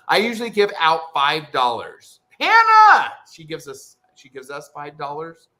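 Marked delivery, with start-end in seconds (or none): 2.4-3.1 high, loud cry of the name; excited shout. Real, not acted. Streamer calling out a generous viewer, excited shout. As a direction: holler the name in delight.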